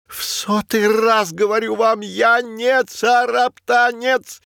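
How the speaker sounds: high, slow, indignant cat voice; protesting high voice. Acted. Belyak protests being pushed.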